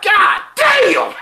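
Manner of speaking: high, loud cry of disbelief; reliving shock at full pitch; shocked outburst. Retold or imitated. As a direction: yell it like a stunned viewer. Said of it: "every death, we was like".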